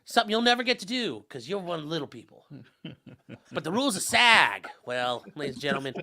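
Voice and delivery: hammed-up big city New York lawyer, lightly done; a mock New York attorney lecturing country folk, not quite landing; bad New York accent. Mock New York lawyer sweet-talking a New Mexico jury, hammed-up accent. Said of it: "Worst New York accent ever".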